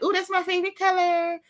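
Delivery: high, sugary fake-friendly coo; imitating a phony new girl in a high voice. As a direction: gush fake compliments up high. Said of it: "phony with this high-pitched voice".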